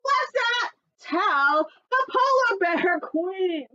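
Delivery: high, slow, gleeful little bird voice; high squeaky bird voice. Acted. Little bird telling friends the secret, high and gleeful. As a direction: squeak it out slowly like a small bird. Animal characters plotting in story.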